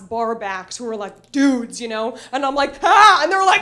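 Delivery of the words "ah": loud, high scream of horror; hammed-up shriek; acted scream. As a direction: scream "Ah" at a gory sight. Shows bloody finger, acted scream.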